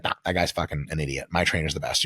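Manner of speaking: low, scornful mock brag; lightly acted one-upping; dismissive client. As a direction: wave off the rival trainer. Client dismisses rival trainer.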